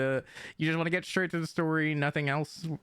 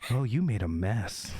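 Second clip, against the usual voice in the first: much lower, suggestive delivery; suggestive voice demo; 8 semitones lower than usual. Shown as a demonstration. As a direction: drop it low, suggestively. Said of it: "It's like me going like".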